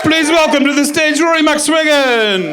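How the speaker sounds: high, loud compere announcing the act; booming announcer impression. Comedian introducing herself to the crowd as a loud announcer. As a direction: boom it out like a club MC introducing the headliner. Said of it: "I just did a really good impression of somebody".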